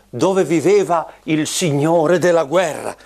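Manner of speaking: ordinary delivery, nothing marked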